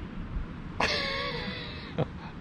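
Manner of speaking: squeaky high-pitched giggle; a bright breathy laugh. Real, not acted. Laughing at dinner joke.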